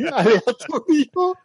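high laugh breaking into the word. Real, not acted. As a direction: laugh it out slowly. Laughs at random search results.

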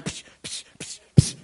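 voiceless "psh" hits; imitated toy fight noises. Boy plays with his action figures, imitated punch noises. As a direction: play out a kid's battle sound effects.

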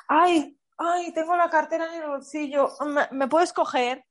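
coy flirt act. Pretend. Woman on a date coaxing the man, coy and high. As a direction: play innocent and helpless to lure him. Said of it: "y le dices".

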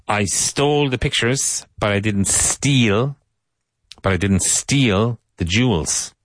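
"stole" pitched high, drawn out slowly; slow teaching model. Shown as a demonstration. Teacher repeating the model sentence slowly for students. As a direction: stretch it for learners. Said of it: "model sentence again".

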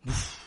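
blown whoosh of wind; imitated air gust. Storyteller imitates the air rushing through the opened door, blown whoosh. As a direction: blow out a short puff of air. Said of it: "ve que entra un aire".

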